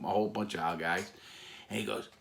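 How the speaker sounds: ordinary delivery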